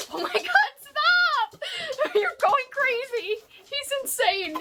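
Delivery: high-pitched, helpless squeal through laughter; laughing squeal. Real, not acted. Watching her dog go crazy with bubble wrap, laughing squeal. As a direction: squeal in delighted disbelief.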